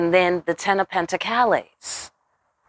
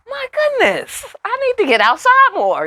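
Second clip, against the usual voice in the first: high, dainty voice of a clueless empress; naive empress; 4 dB louder than usual. Retold or imitated. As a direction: play it high and sheltered, amazed. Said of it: "this bitch is like".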